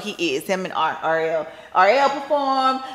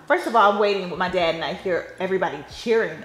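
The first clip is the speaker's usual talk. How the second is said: usual speaking voice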